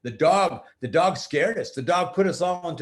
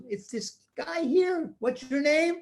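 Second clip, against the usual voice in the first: put-on teen girl voice; 11 semitones higher than usual.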